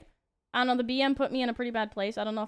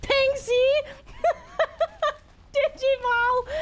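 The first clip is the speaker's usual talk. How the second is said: high, loud excited yelp; excited shout; 7 dB louder, much slower than usual. Real, not acted. Streamer yelling at the game in excitement, shrieking high and loud. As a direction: shout it out in delight. Excited outbursts while gaming.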